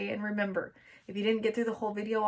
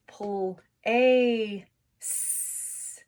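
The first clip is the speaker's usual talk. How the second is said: slow sounding out; 4 dB louder, much slower than usual. Shown as a demonstration. Teacher to kids, very slow sounding out. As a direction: Pull a s stretched very slow. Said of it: "C is making that sound".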